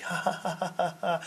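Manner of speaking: mocking laugh at tragedy. Mock laughing to show what they won't do.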